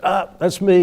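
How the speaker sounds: high-pitched, showy announcement; showy phone response. Retold or imitated. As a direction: call it out, making a big deal of it. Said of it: "made a big deal of answering".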